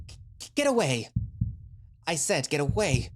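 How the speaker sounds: Cadwell screaming. Acted. The injured man screaming at the unseen attacker, panicked high yell. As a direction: scream at it to get away, in terror. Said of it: "Cadwell screams".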